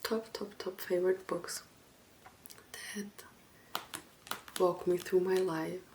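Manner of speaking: gentle ASMR soft-spoken voice with long pauses; soft talking. Acted. ASMR host introducing favorite books to viewers, soft talking. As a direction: talk softly and unhurriedly to the listener.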